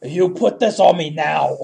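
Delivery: slow, loud, insistent order; loud mock demand; demanding drunk woman. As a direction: imitate a pushy drunk, drawing the words out.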